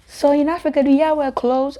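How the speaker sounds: high, naive question; naive lady. Retold or imitated. Lady asking the African girl a naive question, high naive voice. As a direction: ask it innocently. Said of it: "I had one lady ask me".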